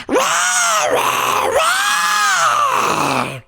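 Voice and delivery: high, loud roaring wail. A narrator's reading. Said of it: "wailed even louder".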